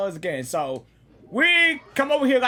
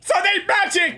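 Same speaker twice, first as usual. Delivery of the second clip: startled shout; 7 semitones higher than usual. Real, not acted. Ambushed by thugs.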